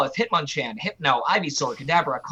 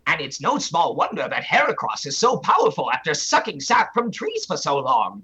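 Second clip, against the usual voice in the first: cartoon professor's explanation; swooping, lecturing professor voice; Professor Oak. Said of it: "This is Professor Oak explaining".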